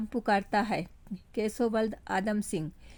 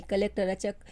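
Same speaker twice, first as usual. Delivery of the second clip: usual speaking voice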